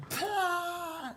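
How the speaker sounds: very high mock burst of laughter; hammed-up squealed guffaw; imitated laughter burst. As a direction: explode like a room cracking up. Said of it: "laughing their asses off".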